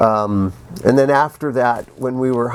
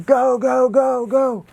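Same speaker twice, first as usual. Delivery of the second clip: high mock yell of "go, go"; retold yell; 12 semitones higher, slower than usual. Harrison hanging on the skid urging takeoff, high mock yell. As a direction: call it out like urging the pilot. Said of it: "and he's yelling".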